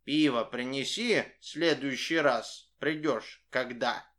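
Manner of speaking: usual speaking voice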